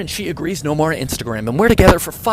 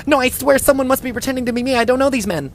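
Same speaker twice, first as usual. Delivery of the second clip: voiced wife; 8 semitones higher than usual. Wife denies cheating, voiced.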